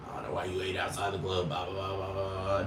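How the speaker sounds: low, fast, mumbled mock of a hater; dismissive hater impression, low and quick. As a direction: sneer it out under your breath like the people you're mocking. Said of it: "No, I'm gonna fucking keep hating".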